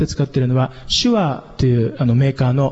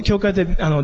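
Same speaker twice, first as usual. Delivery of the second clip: normal talking voice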